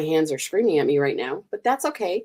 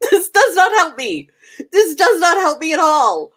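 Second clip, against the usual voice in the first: laughing exasperation; 9 semitones higher, 7 dB louder than usual. Real, not acted. Exasperated at friend's spelling.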